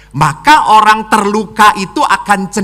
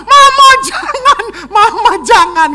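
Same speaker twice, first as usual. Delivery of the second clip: high laughing imitation of sobbing kids; mock crying plea; 5 dB louder than usual. Priest laughingly imitating kids crying for mama. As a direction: wail it like a child, much higher, half laughing.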